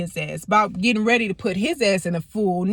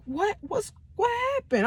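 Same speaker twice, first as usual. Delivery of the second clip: much higher, softer bewildered question; a dazed, hushed what; confused what; 13 semitones higher, 5 dB quieter than usual. Retold or imitated. Seeing her bleeding arm, high and bewildered. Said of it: "And I'm like".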